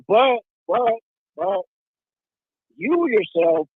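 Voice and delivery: usual speaking voice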